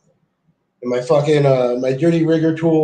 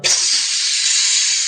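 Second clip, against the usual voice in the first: imitated hiss.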